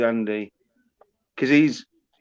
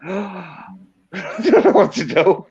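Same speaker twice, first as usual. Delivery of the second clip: mock gasp, then higher-pitched helplessness; stunned followers; 4 semitones higher than usual. Imitating shocked supporters watching the head thrown down, mock helpless. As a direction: gasp and play a crowd at a loss. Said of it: "they're all like".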